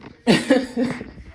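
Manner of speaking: rising airy giggle; breathy laugh. Real, not acted. Mother giggling about her loud son.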